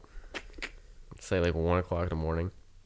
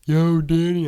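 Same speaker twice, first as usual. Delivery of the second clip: put-on higher, louder drunk greeting; mock drunk caller; 8 semitones higher, 9 dB louder, slower than usual. Drunk friend phones the driver, mock tipsy call. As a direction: play the tipsy friend phoning.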